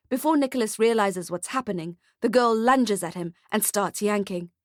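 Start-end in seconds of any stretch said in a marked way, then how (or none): none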